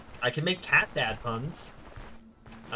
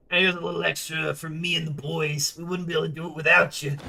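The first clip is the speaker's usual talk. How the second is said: put-on light New Jersey tough-guy accent, a touch louder; 3 semitones higher, 4 dB louder, faster than usual. Streamer reading the contract giver's line to the player, light Jersey accent. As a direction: read the mercenary's thanks in a hint of a Jersey accent. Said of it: "he suddenly has a Jersey accent".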